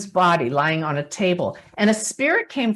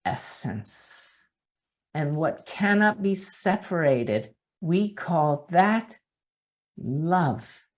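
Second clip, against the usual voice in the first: soft closing blessing; 3 semitones lower, 5 dB quieter than usual. Acted. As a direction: let the words settle gently.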